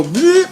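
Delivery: very high silly bleh as the otter; otter lick noise. Retold or imitated. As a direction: squeak out a cartoon animal lick. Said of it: "and they go".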